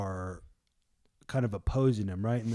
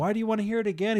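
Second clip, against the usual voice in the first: high, rapid-fire challenge; mock-indignant, pitched way up; exasperated; 11 semitones higher, 6 dB louder, much faster than usual. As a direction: throw the question back fast and loud. Healed man questioned again, exasperated.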